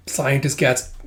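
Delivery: ordinary delivery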